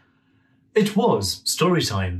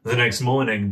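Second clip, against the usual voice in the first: plain reading voice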